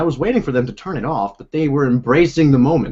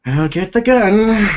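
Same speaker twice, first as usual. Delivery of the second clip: high, slow hammed-up menace; mock dramatic threat; 8 semitones higher, 5 dB louder, slower than usual. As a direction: play it like a movie threat. Mock alarm at intruder.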